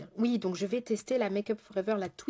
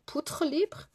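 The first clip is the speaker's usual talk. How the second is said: mock odd accent on "Poutre libre", higher; drôle d'accent; 7 semitones higher than usual. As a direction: echo the slip "Poutre libre" with a hard T, as a question. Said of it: "C'est quoi cet accent que je viens de faire?". (drôle d'accent moqueur, plus aigu, sur « Poutre libre »)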